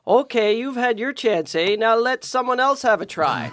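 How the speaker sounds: polite Canadian brush-off. Retold or imitated. Canadian industry telling a successful actor to step aside, mock-polite Canadian accent. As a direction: sweetly show a star the door in a thick Canadian accent. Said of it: "what they say to you is".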